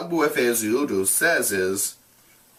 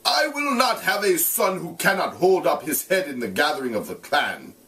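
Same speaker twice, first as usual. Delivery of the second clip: a hint of a harsh, threatening father; mild acted fury; swearing father; 8 semitones higher than usual. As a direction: swear at the son he scorns. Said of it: "he swore".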